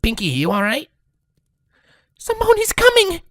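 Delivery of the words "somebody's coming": high, loud sudden alarm; urgent warning. A narrator's reading. Pinkie suddenly warns of danger.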